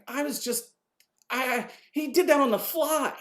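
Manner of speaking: high, loud, real astonishment; excited disbelief; amazed. Real, not acted. Marvelling at an improvised moment.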